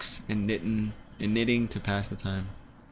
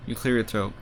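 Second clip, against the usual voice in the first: ordinary talk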